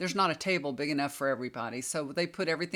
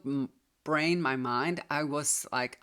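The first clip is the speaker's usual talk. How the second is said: ordinary delivery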